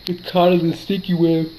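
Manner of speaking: words stretched by a real yawn. Real, not acted. Sleepy player narrating the battle late at night, yawning. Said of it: "Sorry for my yawning".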